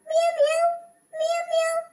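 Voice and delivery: high pretend meowing like a cat; playful high-pitched cat imitation. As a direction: mew like a cat to give yourself away from upstairs. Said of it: "பூனை கத்துற மாதிரி யார் sound போடுறா".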